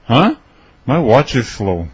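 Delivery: usual speaking voice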